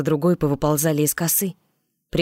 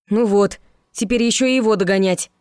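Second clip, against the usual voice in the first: louder, higher bewildered groan; 5 semitones higher, 4 dB louder than usual. Acted. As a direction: sound lost and a bit dozy. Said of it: "растерянно произнёс немного осоловевший Иван".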